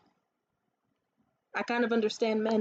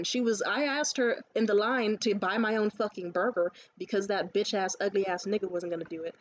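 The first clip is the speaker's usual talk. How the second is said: normal talking voice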